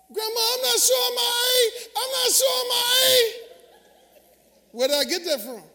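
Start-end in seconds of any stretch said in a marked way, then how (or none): 0.1-3.3 put-on high, loud crying wail of a small child. Child crying out to grandma in store: high loud sobbing plea. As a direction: sob it out like a scared little kid begging. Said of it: "I cried, I said out loud".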